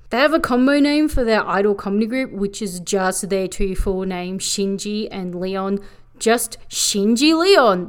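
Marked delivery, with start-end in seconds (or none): none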